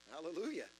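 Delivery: usual speaking voice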